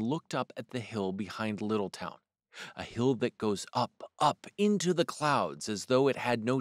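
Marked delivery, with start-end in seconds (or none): none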